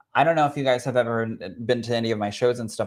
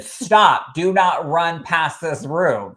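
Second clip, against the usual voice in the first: high, loud call of stop, then slow and firm; retold shout; 5 semitones higher, 5 dB louder, much slower than usual. Security guard shouting at a crying performer to stop. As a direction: bark it like a guard stopping you. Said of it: "Security ran after me and said".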